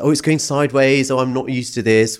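high-pitched mock yelp, imitating mum; retold yelping. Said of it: "stood up and started yelping".

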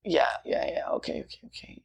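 hushed, near-whispered yeahs and okays; a kid quietly giving in, voice fading away; meek compliance. Retold or imitated. As a kid quietly caving in to pressure, fading to a whisper. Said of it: "you're like".

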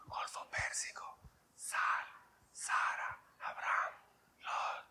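whispered silently, each word apart; breathless hushed whisper. Shown as a demonstration. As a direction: mouth the list without voice. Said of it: "Vamos a hacerlo en silencio".

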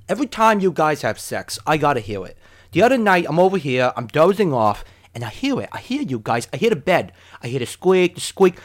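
put-on roommate voice, swooping up on key words; complaining roommate impression. Roommate tells the couple what he overhears, put-on complaining voice. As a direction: imitate an aggrieved roommate's complaint.

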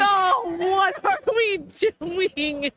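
slow, high, tearful disbelief; overwhelmed and weeping; real sobbing disbelief at the support; crying. Real, not acted. Overwhelmed after big gift.

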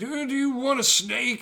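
high, silly offer of a snake; sweetly odd offer; goofy snake guy. Retold or imitated. Snake guy offers the girl a snake, in a high goofy voice. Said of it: "And he's like".

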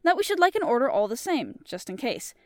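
measured audiobook reading with character voices; storybook reading voice; story narration. A narrator's reading. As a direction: tell the tale steadily to listeners. Reads the story aloud.

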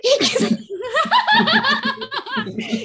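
high-pitched burst of laughter. Real, not acted. Laughs at own quote. (risa aguda)

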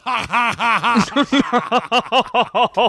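ha ha ha, hammed-up villain laugh; mock evil laugh. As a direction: gloat like a cartoon villain. Mocking laugh after prank call.

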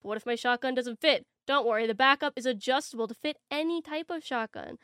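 hyped TV ad pitch; infomercial voice. Pretend. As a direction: sell it like a late-night ad.

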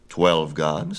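mildly haughty questioning. A narrator's reading. Apollo challenges the baby's count of gods, haughtily. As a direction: query it with a touch of disdain. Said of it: "said Apollo haughtily".

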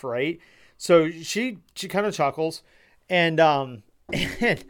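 normal talking voice

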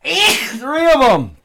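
high, slow disbelieving cry; surprised. Real, not acted. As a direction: marvel at the sneezes. After three sneezes.

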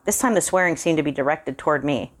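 usual speaking voice

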